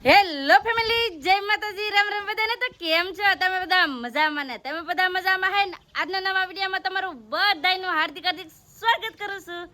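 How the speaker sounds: high, bright-pitched welcome to the family; vlog host greeting. Acted. Host greets her viewers in a high welcoming voice. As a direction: sing out the hello to your viewers.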